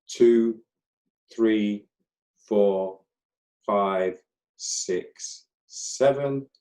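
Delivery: slow, evenly paced count with long gaps; measured breathwork instructor counting; guided breathing count. Acted.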